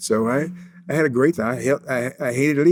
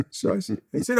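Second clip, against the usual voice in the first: ordinary delivery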